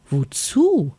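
high questioning call. A narrator's reading. Little bear calls to a snow hare, calling out. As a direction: call the question across to someone. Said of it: "rief Vanille ihm zu".

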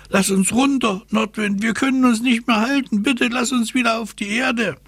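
very high, pleading little-character call; squeaky high plea called to the wind. Acted. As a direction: cry out to be let down in a tiny high voice. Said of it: "Lottchen rief ihm zu".

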